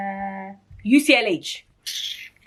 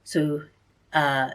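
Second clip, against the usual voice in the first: ordinary talk, nothing marked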